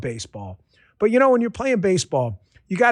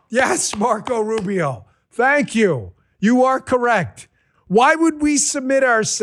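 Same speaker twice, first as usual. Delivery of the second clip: higher, slow, sarcastic cheering; mock cheering; 7 semitones higher, slower than usual. Gushing to Rubio about the court, slow and higher. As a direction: drag it out like a gushing fan.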